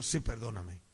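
low, gentle explaining voice; calm, quiet conciliatory reply; soft apology. Retold or imitated. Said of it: "le digo".

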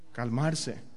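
soft low calm word. Shown as a demonstration. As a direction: say it like you're calming yourself. Demonstrating calm after rhetorical question.

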